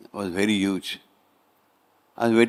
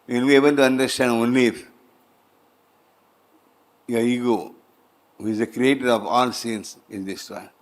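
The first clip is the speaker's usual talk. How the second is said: ordinary delivery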